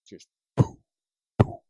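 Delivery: breathy popping noise of trees bursting; whispered poof sound. Retold or imitated. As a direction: puff out an exploding tree sound. Imitating trees exploding.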